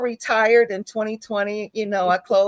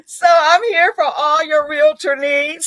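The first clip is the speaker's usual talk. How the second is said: louder, higher, laughing through it; laughing plug; 6 semitones higher, 6 dB louder than usual. Real, not acted. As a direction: pitch yourself while cracking up. Playful self-promotion while laughing.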